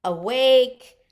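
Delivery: loud chant cue; called out. Shown as a demonstration. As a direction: belt the word with the move.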